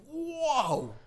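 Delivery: high, drawn-out "Whoa"; floored by what he hears; reacting to the rude dog lady with a high stunned whoa. Shocked reaction retold.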